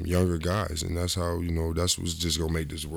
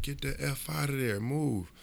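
higher, sharp bark at a teammate; Gary barking; 9 semitones higher, 5 dB quieter, slower than usual. Retold or imitated. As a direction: snap at him to clear out. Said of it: "And Gary said".

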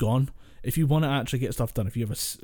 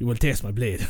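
Spanish orc; 3 semitones lower than usual. Acted. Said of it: "if you're gonna do the Spanish orc".

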